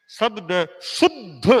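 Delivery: शुद्ध with the half द clearly sounded, slow; careful conjunct. Shown as a demonstration. Teacher showing the conjunct in शुद्ध. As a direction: press the half द in शुद्ध slowly. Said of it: "तो शुद्ध में आधा द फिर ध है".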